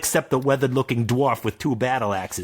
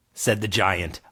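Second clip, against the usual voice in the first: usual speaking voice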